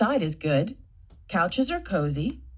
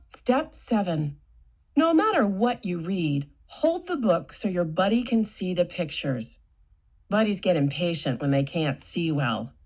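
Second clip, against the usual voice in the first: usual speaking voice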